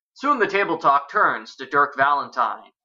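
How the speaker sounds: usual speaking voice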